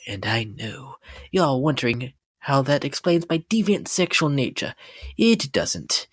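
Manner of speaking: ordinary talk, nothing marked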